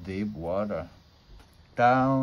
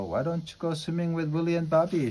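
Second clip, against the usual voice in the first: higher-pitched, mild call from a mother; 6 semitones higher, faster than usual. A narrator's reading. Mother at the window to her son, calling out. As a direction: call out lightly in a raised pitch. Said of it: "she called".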